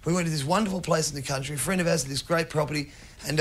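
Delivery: ordinary talk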